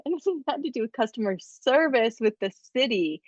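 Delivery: usual speaking voice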